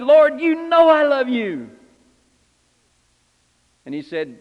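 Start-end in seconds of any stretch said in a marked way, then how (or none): 0.0-1.7 high, loud and slow; impassioned cry. Retold or imitated. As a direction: cry it out leaping to your feet. Peter leaps to his feet.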